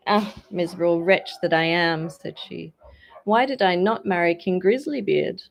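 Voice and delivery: a touch of despair; mild lament. A narrator's reading. Princess laments.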